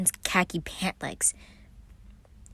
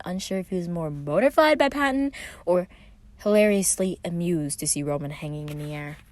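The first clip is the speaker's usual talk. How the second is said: usual speaking voice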